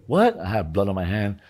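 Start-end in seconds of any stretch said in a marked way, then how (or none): 0.1-0.4 high, quick startled question; retold surprise. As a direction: imitate a partner taken aback. Voicing the lady's startled reply.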